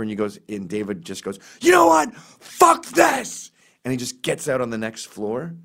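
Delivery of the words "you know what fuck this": much higher and louder shouted outburst; mock blowup. David losing his temper at Nick in the elevator, shouted. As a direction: blow your top and storm off. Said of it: "David just loses his temper".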